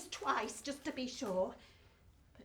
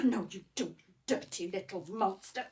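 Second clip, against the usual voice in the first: lower, gruff dad scolding a bug; father's voice; 7 semitones lower than usual. Acted. Father hunting a creepy crawly, low and gruff. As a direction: drop low as the dad cornering the creature.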